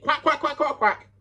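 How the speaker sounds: high, rapid-fire mock quacking. Taunting a rival for talking shit, mocking quack.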